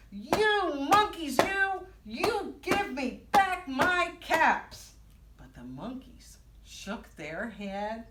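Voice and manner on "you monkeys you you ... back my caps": high, loud, drawn-out scolding; storybook fury at full voice; angry peddler's shout. Acted. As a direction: shout it slowly, pounding each word. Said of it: "and he shouts".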